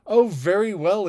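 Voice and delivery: high, loud and delighted; eager exclamation. A narrator's reading. Miss Bates relieved at the dull-things rule, exclaiming eagerly. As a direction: burst out eagerly. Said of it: "exclaimed Miss Bates".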